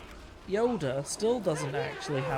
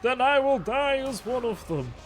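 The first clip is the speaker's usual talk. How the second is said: dramatic declaration; 9 semitones higher, 3 dB louder than usual. Pretend. Repeats line, dramatic.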